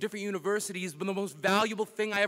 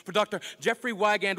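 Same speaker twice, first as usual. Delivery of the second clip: usual speaking voice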